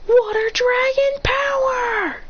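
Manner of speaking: high, slow, stretched-out shout; attack shout. Acted. Misaki pitching in, shouting her attack slowly. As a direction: bellow the move name like an anime hero. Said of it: "Misaki goes".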